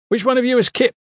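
high, loud questioning challenge; shouted demand. A narrator's reading. A stranger in the hall demands Kip, shouting. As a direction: call it out across a hall. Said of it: "an unfamiliar voice shouted".

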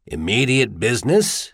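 "Immediate business?" with a rise at the end; questioning echo. A narrator's reading. Steiner echoing Caleb's words, questioning. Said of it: "Steiner repeated".

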